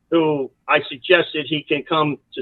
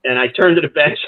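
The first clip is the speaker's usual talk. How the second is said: ordinary delivery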